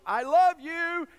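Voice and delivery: put-on boy yelling to the canyon as his mother told him. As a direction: shout it across the canyon. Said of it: "as loud as you can, yell".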